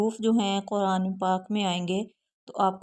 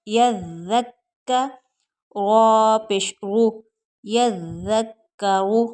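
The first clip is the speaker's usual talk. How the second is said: slow, firm letter-by-letter recitation; a Quran teacher dictating syllables; slow spelling drill; 4 dB louder, much slower than usual. Shown as a demonstration. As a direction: sound out every letter slowly for the class. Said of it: "spelling drill".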